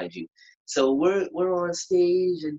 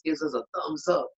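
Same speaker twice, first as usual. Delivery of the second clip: usual speaking voice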